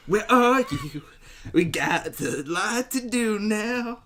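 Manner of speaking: high loud slow mock calling; drunk yelling outside. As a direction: holler into the dark, hammy. Calling out in the night.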